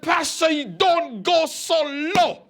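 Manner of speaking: high, drawn-out plea of protest; indignant protest. Retold or imitated. Shocked evangelist objects to his pastor's threat, high indignant protest.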